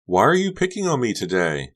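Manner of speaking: high, loud hurt demand; demanded tearfully. Acted. Honey demands of Becca near tears, high and loud. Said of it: "Honey demanded".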